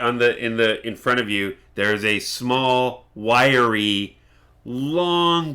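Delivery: usual speaking voice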